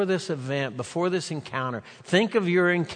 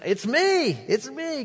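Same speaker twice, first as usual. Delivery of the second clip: much higher, joyful calling out; excited shout; 12 semitones higher than usual. Acted. Healed blind man calling to his neighbors, excitedly. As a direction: burst out with it, thrilled.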